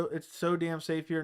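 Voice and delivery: ordinary talk, nothing marked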